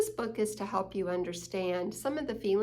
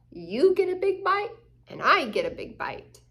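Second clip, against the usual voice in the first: playful excited sharing; 6 semitones higher, 5 dB louder than usual. Acted. Excited sharing, higher louder.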